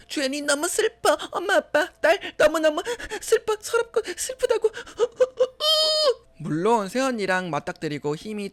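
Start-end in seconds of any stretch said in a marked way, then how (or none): 0.1-4.7 very high-pitched mock sobbing; hammed-up wailing for parents' sympathy; pitiful act. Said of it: "더 애처롭게 행동했어요". 4.7-6.1 long, very high fake wail; hammed-up high-pitched sobbing; mock sob. Fake crying to parents.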